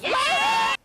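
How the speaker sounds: high joyful yell; delighted little whoop; excited cheer. Real, not acted. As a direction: cheer right along. Reporter cheering along with clip.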